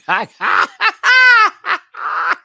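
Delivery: high burst of laughter; loud high cackle; loud shrieking laugh. Real, not acted. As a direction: let out a big shriek of laughter. Big laugh.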